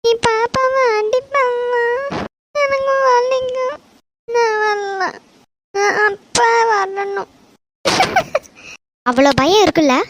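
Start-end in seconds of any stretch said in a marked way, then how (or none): 0.0-7.3 put-on high, drawn-out wailing child; crying. Scolded child fears a smack and wants father, crying. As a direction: plead slowly through tears. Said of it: "எதுக்கு அழுறா?".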